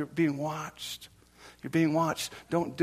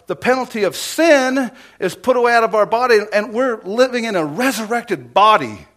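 loud, high, impassioned sermon delivery; raised preacher's cry with punched key words; full-force preaching; 9 semitones higher, 12 dB louder than usual. Real, not acted. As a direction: hammer it home to the congregation.

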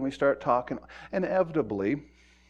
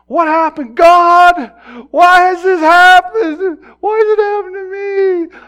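much higher and louder, a sobbing wail; anguished wailing; 18 dB louder, slower than usual. Retold or imitated. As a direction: cry out to God over a dead son. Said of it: "she was yelling to the top of her lungs".

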